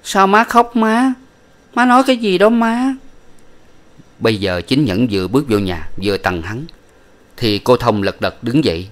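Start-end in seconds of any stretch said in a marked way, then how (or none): 0.0-2.9 put-on small child asking. A narrator's reading. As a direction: ask like a bewildered small child. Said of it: "nên nó hỏi rằng".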